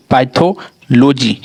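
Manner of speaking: Pathology drawn out slowly, every syllable clear; slow model word. Shown as a demonstration. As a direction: say pathology slowly for the class to repeat. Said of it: "इसे हम पढ़ेंगे psychology".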